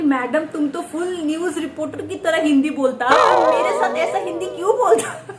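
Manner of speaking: lightly voiced helper's broken Hindi, amused; quoting helper. Helper asks madam why she spoke broken Hindi with her, playful mimicry. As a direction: mimic her surprised complaint. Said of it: "ये मुझे बोल रही है".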